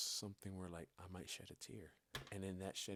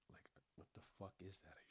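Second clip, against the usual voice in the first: mock whisper; 11 dB quieter, faster than usual. Mocking the idea of journaling.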